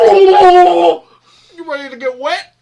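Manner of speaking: very high, loud squeal; squeaky voice. Retold or imitated. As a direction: squeal it like a toy. Voicing the water pack.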